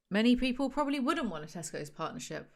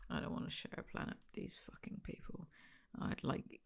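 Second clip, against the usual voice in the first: under her breath; 15 dB quieter than usual. Real, not acted. Muttered aside in disgust.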